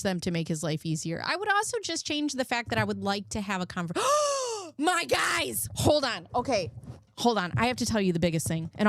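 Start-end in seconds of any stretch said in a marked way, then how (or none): 4.1-4.7 sudden high gasp of realization; sharp gasp. Real, not acted. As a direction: gasp as if something just clicked. Sudden realization mid-sentence.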